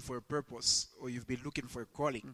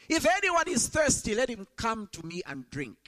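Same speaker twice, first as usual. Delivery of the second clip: crying out; 8 semitones higher, 4 dB louder than usual. Retold or imitated. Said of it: "Jesus stood and cried out".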